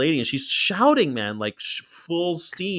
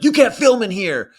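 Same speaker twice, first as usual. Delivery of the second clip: retold shout; 8 semitones higher, 7 dB louder than usual. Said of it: "They're like".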